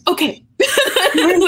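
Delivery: slow, high, laughing through the words; words stretched by laughter. Real, not acted. Laughing at mom's reply.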